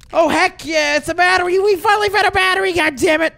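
high, elated celebration; excited triumph. Real, not acted. Player finally finding a battery, excited triumph. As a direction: crow over a lucky find, pitch lifted.

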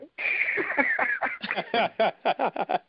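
high, drawn-out laughing fit; long amused laugh. Real, not acted. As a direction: crack up helplessly, high-pitched. Caller cracking up at the talk.

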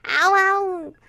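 high, loud yelps of pain. Acted. Kicked ball hurts her foot, loud pained yelps. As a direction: yelp like a stubbed foot.

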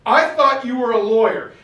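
loud, high, fuming rebuke of a subordinate; raging at a colleague who went behind your back; furious outburst. Retold or imitated. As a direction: blow your stack at him. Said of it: "he blows his stack".

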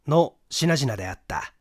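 plain reading voice

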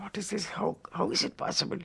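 hushed, breathless disbelief; astonished whisper. Retold or imitated. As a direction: whisper it as if seeing a miracle. Inner awe at vision.

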